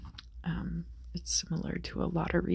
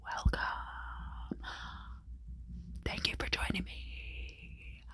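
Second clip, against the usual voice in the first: imitated tarot-reader welcome. Mimicking YouTube astrologers welcoming their viewers, breathy whisper. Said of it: "it's all like".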